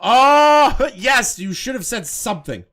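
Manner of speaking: laughing retort. Real, not acted. Cuts in during argument.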